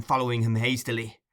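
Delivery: plain reading voice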